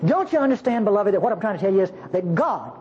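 ordinary delivery

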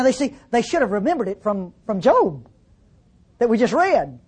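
normal talking voice

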